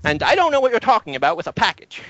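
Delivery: high, quick brush-off; dismissive professor. Acted. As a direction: wave her off like a stingy boss. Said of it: "He says".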